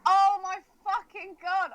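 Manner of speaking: ordinary talk, nothing marked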